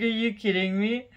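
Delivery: high, disbelieving exclamation; incredulous. Retold or imitated. Wife reacting to husband's news, mock disbelief. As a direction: toss it off in half-laughing disbelief. Retelling reaction to rejected pallets.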